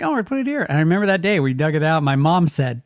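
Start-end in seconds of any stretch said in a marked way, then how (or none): 0.0-0.6 put-on much higher, chirpy kid voice; be the eager little sister; little girl voice. Young sister deciding where to plant the tree, childlike. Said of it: "But Lee's like".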